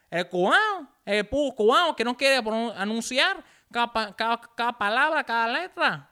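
high, loud mock Cuban accent; hammed-up Cuban-accented Spanish; bad Cuban accent. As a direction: tease in a poor Cuban accent. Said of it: "that's not a good Cuban accent".

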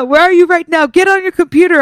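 high, insistent mock demand; imitating relatives. Relatives badgering her to join the Zoom, imitated high nagging. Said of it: "And then they're like".

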